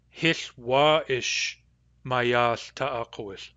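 Hith wa ish mayath ta'akowith, said slowly with a long wa ish; Nuu-chah-nulth phrase. Shown as a demonstration. Teacher reporting the news to Lindsay as Hith wa ish mayath ta'akowith. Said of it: "the vowel of wa ish is long".

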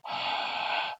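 a soft, raspy throat breath without voice; back H demo. Shown as a demonstration. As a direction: blow air hard through a narrowed throat. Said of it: "That's a back H".

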